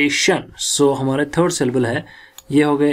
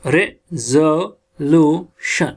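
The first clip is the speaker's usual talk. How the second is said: "Resolution" very slowly, split into syllables; teacher spelling out the sounds of "Resolution"; syllable-by-syllable demo; much slower than usual. Shown as a demonstration. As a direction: sound out "Resolution" with a gap after each syllable. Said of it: "हम पढ़ते हैं इधर".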